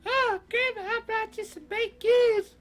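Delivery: put-on very high little-girl falsetto. Little Red bringing Grandma baked goods, high girlish voice.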